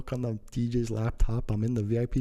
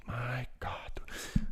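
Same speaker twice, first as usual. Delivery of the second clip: soft, voiceless reaction; hushed retold exasperation; whispered disbelief; 2 semitones higher, 7 dB quieter, much slower than usual. Retelling reaction to being removed.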